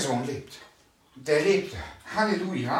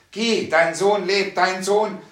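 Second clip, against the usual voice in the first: loud, slow, drawn-out proclamation; proclaiming; 4 semitones higher, 6 dB louder, slower than usual. Retold or imitated. Preacher voicing the Lord healing the son, loud and slow. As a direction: declare it with full authority. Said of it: "Also hat einen Satz gesagt".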